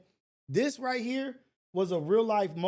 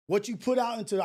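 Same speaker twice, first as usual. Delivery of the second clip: about to cry; 6 semitones higher, 4 dB louder than usual. Real, not acted. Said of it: "I was about to start crying".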